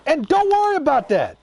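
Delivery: real worked-up tirade; furious rant. Real, not acted. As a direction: vent your outrage at the filmmakers. Heated rant about the movie.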